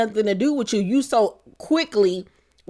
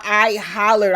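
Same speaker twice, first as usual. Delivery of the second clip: ordinary talk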